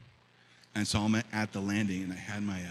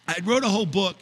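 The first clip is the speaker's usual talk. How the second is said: higher, louder pulpit preaching; 6 semitones higher, 5 dB louder than usual. Acted. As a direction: preach it to the congregation. Preaching the scripture passage.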